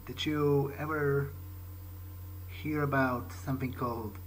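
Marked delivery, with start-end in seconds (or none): none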